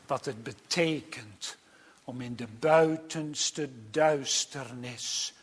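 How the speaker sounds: zachte, lage, plechtige preekstem; plechtige preek. Acted. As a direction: spreek gedempt en gewichtig, met lange pauzes. (plechtig en ingetogen prediken)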